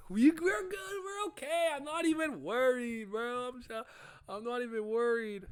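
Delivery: high-pitched, overconfident bluster; fake bravado. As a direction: pretend you're not nervous at all. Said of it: "this is fake bravado".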